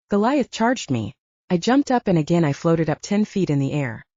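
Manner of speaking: usual speaking voice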